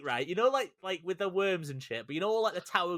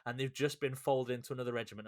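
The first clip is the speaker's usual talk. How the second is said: normal talking voice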